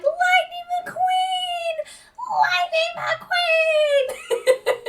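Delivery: very high, long drawn-out shriek; fangirl car squeal. Retold or imitated. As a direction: squeal it like a tiny adoring fan car, stretching every syllable. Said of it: "like those little mini, um, Lightning McQueen girl cars whenever they're like".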